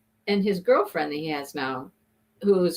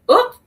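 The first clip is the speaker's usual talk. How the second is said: quick high "oop"; little rising yelp of near collision; startled oop; 10 dB louder than usual. Shown as a demonstration. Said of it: "you go".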